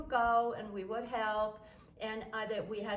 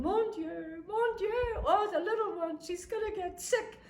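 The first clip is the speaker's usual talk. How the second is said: high, loud, worried cries; fretful French grandma; 10 semitones higher, 4 dB louder than usual. Retold or imitated. Grandmother fretting that the little girl will get sick, high and worried. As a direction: fuss over the little one in the rain. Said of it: "my grandmother's going".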